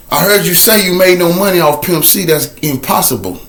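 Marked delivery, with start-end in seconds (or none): none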